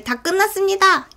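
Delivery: lightly voiced policeman addressing a crowd; acted announcement. Policeman tells townspeople it is over, acted announcement.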